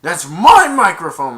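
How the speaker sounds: imitated furious outburst; angry yell. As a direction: yell it like he's defending his mic.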